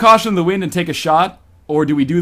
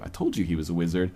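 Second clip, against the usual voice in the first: normal talking voice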